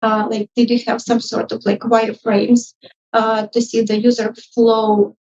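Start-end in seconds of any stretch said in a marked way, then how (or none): none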